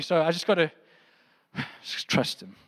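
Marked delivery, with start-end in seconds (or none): 1.5-2.5 low, slow, breathy sigh of giving up; mocking a lazy believer, sighed out; resigned sigh. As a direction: exhale it like you've given up.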